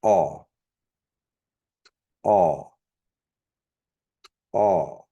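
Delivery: Aw said as k'aw with a popped k. Shown as a demonstration. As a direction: pop the k of Aw. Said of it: "And then the popped K".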